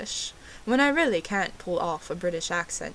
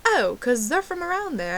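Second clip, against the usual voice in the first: higher-pitched mock American waiter; American accent; 7 semitones higher, 4 dB louder than usual. Waiter explaining terriers to the fake Brit, imitated American accent. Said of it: "That's my American accent".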